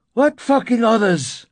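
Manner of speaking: high, loud, angry question; shouted. A narrator's reading. Narrator pulling at the door handle, angry shout. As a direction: explode with frustration. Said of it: "I shouted".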